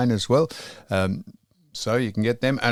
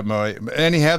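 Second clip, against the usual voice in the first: usual speaking voice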